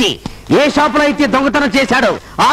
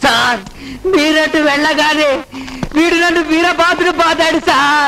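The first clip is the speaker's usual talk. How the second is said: whining; slower than usual. Acted. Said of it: "ఎందుకు ఏడుస్తున్నావయ్యా?".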